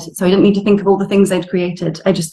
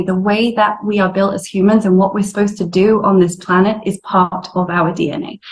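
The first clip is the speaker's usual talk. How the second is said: ordinary talk, nothing marked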